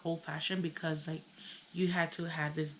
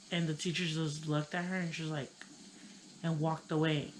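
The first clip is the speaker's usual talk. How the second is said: ordinary talk, nothing marked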